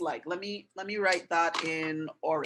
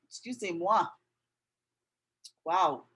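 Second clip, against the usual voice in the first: ordinary talk, nothing marked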